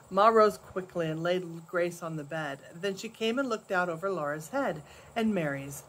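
ordinary delivery

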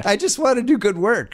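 high-pitched, put-upon pleading; aggrieved plea. Retold or imitated. Acting out own protest to ESPN.

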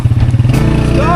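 high, loud, breathy let's go; excited shout. Real, not acted. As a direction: yell it out as the ride sets off. Shouted take right after.

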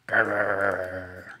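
low playful growl; a mock scary growl; hammed-up alligator growl. Alan practising scary faces.

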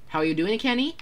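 ordinary talk, nothing marked

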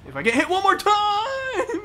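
very high, loud cry of alarm; alarmed wail. Real, not acted. Player one hit from death, alarmed wail. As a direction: wail it, stretching the last word. Player about to die in game.

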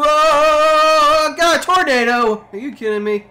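high, loud alarmed yelling; excited shout. Real, not acted. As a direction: cry out as the tornado hits. Tornado appears in game.

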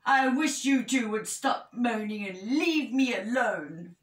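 understated exasperated outburst; cross little storybook bear; mildly cross. A narrator's reading. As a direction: tell them off with a touch of crossness. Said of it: "said Little Bear crossly".

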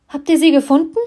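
loud, high burst of hope; hopeful outburst. Acted. Eddie asks her dad about Leila, loud hopeful outburst. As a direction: grab at the hope, almost shouting. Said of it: "Hoffnung steigt in meiner Brust auf".

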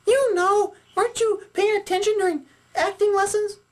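very high puppet voice; Sally puppet, chiding. Acted. Sally chides Jeremy about acting lessons, chiding puppet voice. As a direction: quiz a partner in a high squeak.

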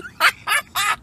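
high burst of disbelieving laughter; surprised laugh. Real, not acted. To viewers after the note is taken, a high startled laugh. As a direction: laugh out in shock.

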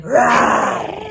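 low, loud playful roar; lion roar. Pretend. Teacher showing the class a lion's roar, playful. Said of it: "You have a lion".

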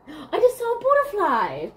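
high, thrilled exclamation; excited. Real, not acted. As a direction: cry out at a sudden delightful sighting. Sudden sighting of a butterfly.

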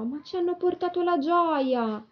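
high, lengthened happy protest; tiny voice overflowing with joy; joyful exclamation. A narrator's reading. Little flower answers the grumbler, joyful. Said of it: "esclamò".

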